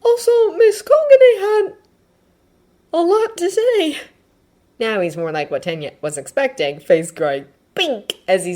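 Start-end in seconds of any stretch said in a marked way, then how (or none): 0.0-4.0 very high voice with a halting pause; hesitant Midoriya. Acted. Midoriya reporting on the nurse's visit, high and hesitant. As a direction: report it high, breaking off midway.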